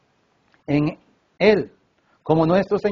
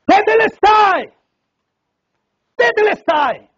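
full-voiced high cry, drawn out; triumphant shout; 14 semitones higher, 7 dB louder, slower than usual. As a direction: shout it out with all your strength. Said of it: "para poder gritar".

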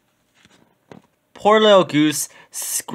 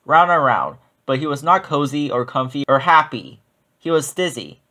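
ordinary delivery, nothing marked

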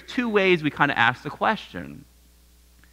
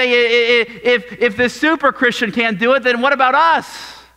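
higher, louder anxious question; worried alarm; 7 semitones higher, 4 dB louder than usual. Retold or imitated. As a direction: voice the panic of the ordinary believer. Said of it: "And we say".

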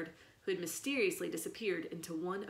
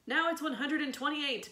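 bellowed; 7 semitones higher, 3 dB louder than usual. A narrator's reading. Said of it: "Miss Crowley bellowed".

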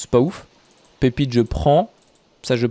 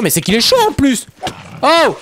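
high, loud yelp of protest; startled protest; 10 semitones higher, 4 dB louder than usual. Real, not acted. Player attacked by a feisty creature, startled protest. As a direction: get louder and higher, ending in a yelp.

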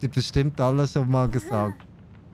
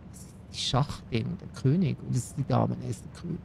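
whispered, much softer, like sharing a secret; secretive whisper; 4 semitones lower, 8 dB quieter, faster than usual. Acted. Telling the chat a secret in a whisper. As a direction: hush it, as if nobody else may hear. Ich sag, das ist ein Geheimnis.

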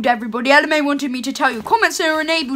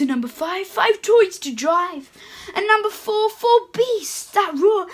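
put-on lively read-aloud for children; storybook reading; 2 semitones higher, slower than usual. Santa reading a picture book to children, sing-song.

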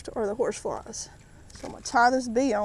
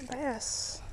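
ordinary delivery

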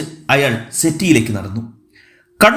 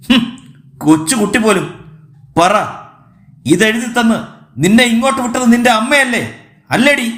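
scornful, higher-pitched accusing questions; angry interrogation; 6 semitones higher than usual. A narrator's reading. Man accuses child's mother of sending her, angrily. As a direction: press the child hard for a confession.

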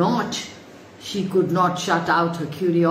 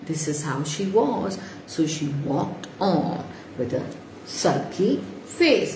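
plain reading voice

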